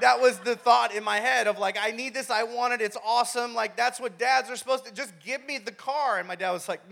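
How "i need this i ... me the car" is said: put-on high, insistent teen pleading; mock demanding. Teen thinking dad should give the car, mock demanding. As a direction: pile up demands in a raised, entitled kid voice. Teen's demanding thoughts voiced.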